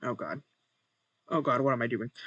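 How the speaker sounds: usual speaking voice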